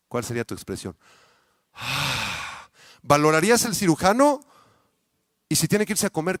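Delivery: ordinary delivery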